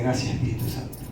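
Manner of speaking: breathy whispered gratitude; whispered thanks. Retold or imitated. Preacher silently thanking the Holy Spirit, whispered. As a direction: whisper it inwardly.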